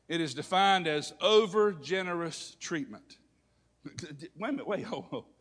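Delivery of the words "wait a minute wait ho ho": normal talking voice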